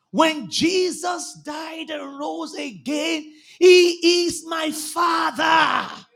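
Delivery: high, drawn-out preacher's proclamation; impassioned preaching. Real, not acted. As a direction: declare it slowly with full conviction.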